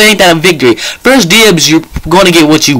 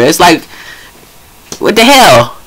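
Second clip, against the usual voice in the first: normal talking voice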